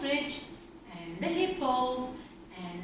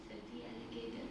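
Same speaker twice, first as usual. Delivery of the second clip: usual speaking voice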